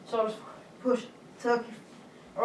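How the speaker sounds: usual speaking voice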